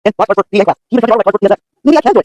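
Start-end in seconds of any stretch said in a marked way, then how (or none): 1.8-2.2 high character voice. Acted. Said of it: "said Moto".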